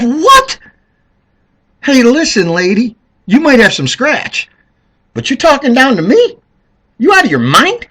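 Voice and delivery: high, loud tough-guy retort; indignant. Retold or imitated. Telling Hillary off for talking down, loud high indignation. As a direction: tell the lady off. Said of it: "you gotta say to yourself".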